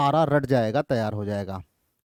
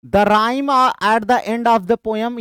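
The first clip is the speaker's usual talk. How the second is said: dragged out, a bit higher and louder; slow emphatic reading; 4 semitones higher, 4 dB louder, much slower than usual. Shown as a demonstration. Teacher rereading an option aloud, slowly and emphatic. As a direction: lean on each word for the class.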